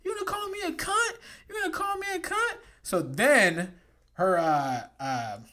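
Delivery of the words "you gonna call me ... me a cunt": high-pitched mock female voice, softer; offended woman. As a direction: imitate her challenging him, high and thin. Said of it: "and she was like".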